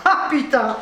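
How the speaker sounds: laughing through the swear; real nervous laugh; nervous laughter. Real, not acted. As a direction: giggle it out, nerves showing. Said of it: "Je ris, c'est nerveux là".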